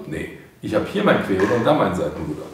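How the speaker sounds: ordinary talk, nothing marked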